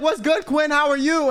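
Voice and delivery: high-pitched, lively imitated hello; upbeat greeting. Producer greeting a contestant in line, upbeat.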